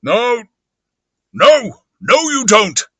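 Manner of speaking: weird voice, high and loud; forceful, strange refusal; Invisible Man. Acted. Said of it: "now the Invisible Man, weirdly".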